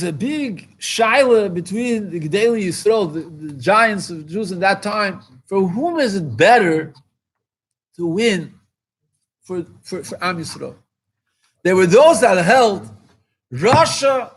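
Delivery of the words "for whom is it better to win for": ordinary talk, nothing marked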